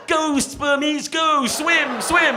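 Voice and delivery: loud, high, slow cheering yell; cheering shout. Retold or imitated. Doctor in video cheering swimmers into pool. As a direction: urge the swimmers on loudly.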